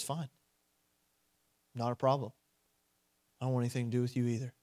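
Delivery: low, soft, matter-of-fact refusal; understated, hushed rejection; quiet dismissal. Acted. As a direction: turn them away quietly, without arguing. God's curt acted reply.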